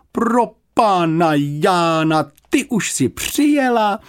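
high, slow startled housekeeper; blurted in alarm. A narrator's reading. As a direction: gasp it out in shock. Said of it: "vyhrkla zděšeně".

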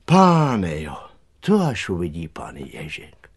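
airy exclamation sinking into near whisper; sighing dismay. A narrator's reading. Cook looks at trampled garden, sighing in dismay. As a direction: sigh it out, fading as it ends. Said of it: "a povzdychla si".

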